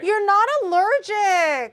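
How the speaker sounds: loud, slow, high protest; loud disbelief. Real, not acted. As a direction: call out his excuse. Arguing over tomato.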